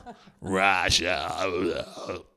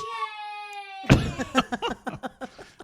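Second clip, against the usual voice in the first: laughing. Real, not acted.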